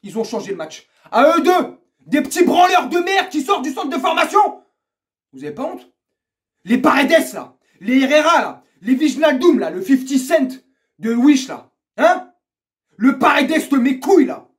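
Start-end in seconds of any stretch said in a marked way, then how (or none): 1.1-4.4 high, loud outraged rant; angry shouting. Real, not acted. Fan blasting his team over youngsters, furious shout. Ranting about players after match. 6.6-14.5 loud, high contemptuous shouts; angry name-calling. Real, not acted. Fan listing players he despises, scornful shouting.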